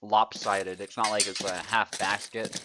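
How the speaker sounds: lively caster commentary, punching the action calls; live play-by-play. Acted. Commentating a sword duel for stream viewers, lively play-by-play. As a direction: call the fight as it happens for your stream.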